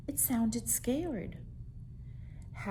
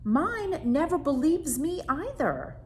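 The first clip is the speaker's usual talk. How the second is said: put-on boy complaining; 9 semitones higher, 5 dB louder than usual. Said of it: "said Howard".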